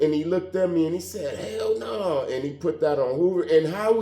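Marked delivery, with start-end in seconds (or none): 1.4-2.3 high-pitched, drawn-out denial; emphatic denial. Retold or imitated. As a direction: swear you didn't do it, pitch up high. Retold emphatic denial when asked.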